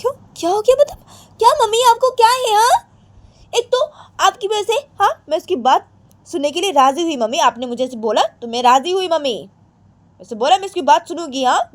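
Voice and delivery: shrill-pitched stubborn daughter; petulant Radhika. Acted. Radhika refusing her mother.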